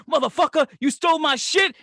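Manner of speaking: high, loud pretend shout at the thief; imagined kicking in the door, yelling at the thief; mock furious yell.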